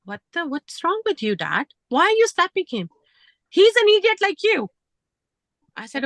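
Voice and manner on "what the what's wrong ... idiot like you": higher and louder, reliving the fight; mock yell at the father-in-law; retelling a shouted argument; retold angry confrontation. Acted quarrel with father-in-law.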